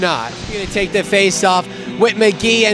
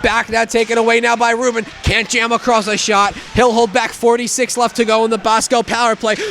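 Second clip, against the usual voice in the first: ordinary talk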